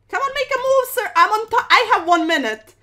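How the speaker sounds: loud, high-pitched frantic plea; frantic urging. Real, not acted. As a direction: urge the opponent on against the clock. Urging slow opponent, one minute left.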